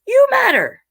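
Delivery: high, loud you matter; bright excited title read; emphatic. Acted. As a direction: sing it out to the kids.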